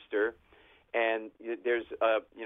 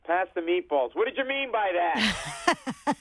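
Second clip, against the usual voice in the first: higher, louder, shouting ordinary table talk; mock family yelling; 8 semitones higher, 3 dB louder, slower than usual. Italian family yelling across the Sunday dinner table.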